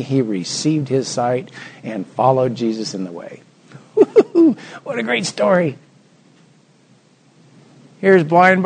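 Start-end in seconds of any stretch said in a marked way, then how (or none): 4.0-4.8 high, loud "hoo-hoo" burst; delighted whoop. Real, not acted. Preacher reacts to a great story, delighted whoop.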